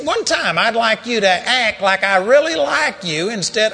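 loud, high pleading rebuke; exasperated owner shouting at a cowering dog; angry scolding. Retold or imitated. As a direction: yell it at the dog in frustration. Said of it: "reading the riot act of this dog".

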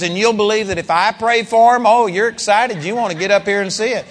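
ordinary delivery

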